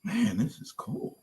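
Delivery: high, hushed, slow wonder; re-enacted soft amazement; awed. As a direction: marvel softly at what you hear. Awed at first hearing The Roots.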